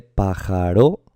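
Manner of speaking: pájaro said slowly as pa-ja-RÓ, last syllable stressed and rising; wrong stress on RO. Shown as a demonstration. Teacher testing stresses aloud, pájaro slowly ending on a stressed RÓ. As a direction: misplace the stress of pájaro onto the final ro. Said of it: "Pues no se dice pájaro".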